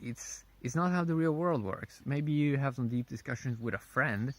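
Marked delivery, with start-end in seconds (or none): none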